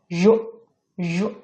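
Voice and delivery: "জ", "জু" said as a buzzed ZH, as in pleasure; buzzing ZH sound. Shown as a demonstration. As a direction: push "জ", "জু" forward through the teeth as ZH. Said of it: "ভিতর থেকে একটু সামনের দিকে pressure দিয়ে ছেড়ে দিবেন". ("জ", "জু" দাঁতের ফাঁকে চাপ দিয়ে ZH ধ্বনিতে)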